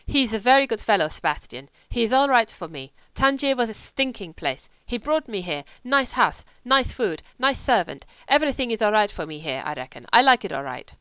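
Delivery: a hint of a lazy wolfish German drifter; German legionnaire. German voiced in the story.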